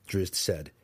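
plain reading voice

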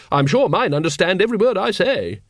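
high, urgent lady's voice; Lady Ramkin's indignant aside, pitched high; hissed. Acted. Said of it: "hissed Lady Ramkin".

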